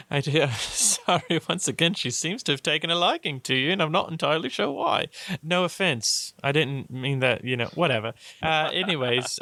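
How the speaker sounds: high, lilting ladylike voice; Lady Bianca. Acted. Lady excusing the child's liking for the player, high lilting voice.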